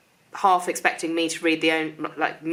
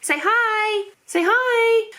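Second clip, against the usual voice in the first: very high coaxing voice, a bit louder on the repeat; 5 dB louder, much slower than usual. Real, not acted. Mother urging her toddler to greet the viewers, high coaxing baby talk.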